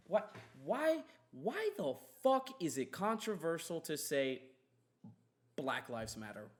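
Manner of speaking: ordinary delivery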